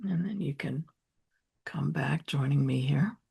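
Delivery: unhurried, softening meditation voice with long pauses; gentle grounding guidance, hushed by the end; guided meditation. Real, not acted.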